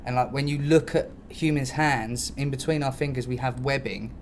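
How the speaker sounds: ordinary talk, nothing marked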